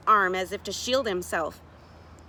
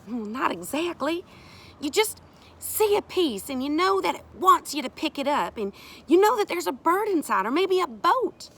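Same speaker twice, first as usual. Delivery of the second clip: higher, animated explanation; earnest woman character; 8 semitones higher, 5 dB louder than usual. Acted. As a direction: let them in on the craft. Sari explains seeing shapes in wood.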